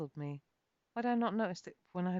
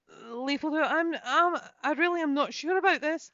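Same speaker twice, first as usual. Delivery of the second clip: higher, louder, stammering Irish brogue; fearful stammer, voice pitched up; nervous plea; 10 semitones higher, 8 dB louder than usual. A narrator's reading. Kate on the wobbling bridge, pleading nervously with Leifildur. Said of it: "I muttered".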